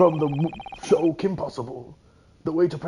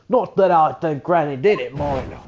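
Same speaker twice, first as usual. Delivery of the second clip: broad Cockney with "mind" as "moin"; stronger Cockney accent; 6 dB louder than usual. Acted. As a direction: lay the Cockney on thick. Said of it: "I gotta do more of a Cockney accent".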